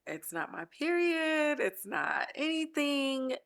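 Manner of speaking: high, drawn-out tearful protest. Real, not acted. Host excusing her tears to listeners, tearful. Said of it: "started crying".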